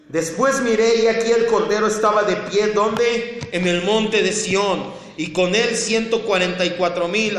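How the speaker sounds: loud, high, ringing scripture proclamation; forceful preaching. Acted. Pastor declaims Revelation to the congregation, forceful preaching. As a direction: proclaim it at full force to the hall.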